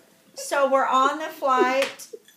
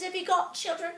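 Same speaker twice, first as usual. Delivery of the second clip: put-on English accent; 5 semitones higher, faster than usual. English lady asking about children, higher imitated English accent. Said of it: "from England".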